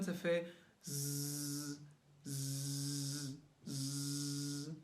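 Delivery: low, soft buzzing Z like a vibrating phone. Shown as a demonstration. Singing teacher shows pupils the phone-buzz Z, low and soft. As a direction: hum a long Z through the teeth. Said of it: "comme un vibreur de téléphone".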